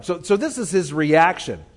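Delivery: ordinary talk, nothing marked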